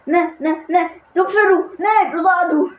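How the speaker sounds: fumbling with the controls and losing it; flustered panic. Real, not acted. Panicking over the plane controls. (zmatená panika, vyšší a hlasitější)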